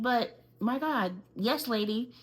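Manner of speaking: usual speaking voice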